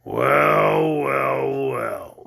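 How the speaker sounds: low, sulky grumble fading away; surly. Acted. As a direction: give in grudgingly. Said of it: "said the goat surlily".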